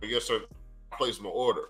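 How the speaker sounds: ordinary delivery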